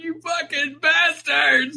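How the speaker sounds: high, slow mock yell at the screen; mock outrage. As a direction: curse the filmmakers in mock fury. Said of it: "and I'm like".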